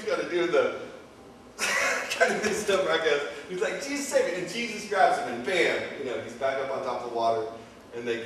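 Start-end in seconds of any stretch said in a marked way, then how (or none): 3.8-4.4 high, acted plea; mock cry for help. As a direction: play a sinking man's yelp, pitched up. Voicing sinking Peter's cry for help.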